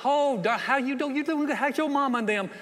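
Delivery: high, gushing mock-sweet small talk; hammed-up Southern sweetness to someone's face; smiling Southern greeting. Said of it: "We smile, look at you and go".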